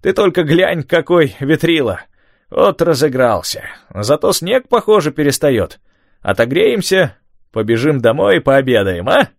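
put-on lively old man, cheerful. A narrator's reading. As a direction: voice the old fisherman, upbeat. Tom's voice in the story.

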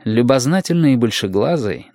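ordinary delivery

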